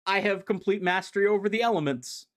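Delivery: a hint of a pompous wizard bragging; smug Naugus. Recapping the comic, Naugus brags in a lightly put-on voice. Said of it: "Naugus is like".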